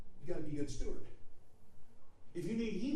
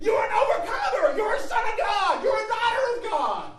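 high, loud, driving sermon delivery; impassioned pulpit shout; full-force preaching; 12 dB louder than usual. Real, not acted. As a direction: preach it out at the top of your range.

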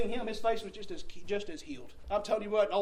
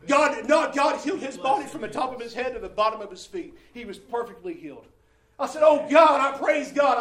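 preaching at full force; 3 semitones higher, 9 dB louder than usual. Acted. Preacher exulting over his son's healing, loud. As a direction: shout the healing out in triumph.

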